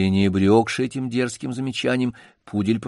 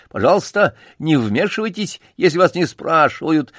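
высоко и громко одёргивая; лакей выскочка кричит на чужака; крик; 7 semitones higher, 3 dB louder than usual. Acted. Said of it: "закричал". (громко и высоко одёргивая)

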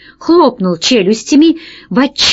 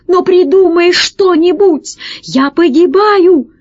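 high, pleading cry of a doomed character; desperate plea; 8 semitones higher than usual. A narrator's reading. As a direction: beg a friend to save you. Pinned pirate begs for help. (отчаянная мольба о помощи)

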